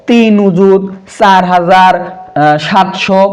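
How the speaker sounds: very slow, each place value drawn out; digit-by-digit reading. Shown as a demonstration. Teacher showing pupils how not to read a number, slow and spaced out. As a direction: read the number out piece by piece, slowly. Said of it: "তাহলে কি আমরা বলবো".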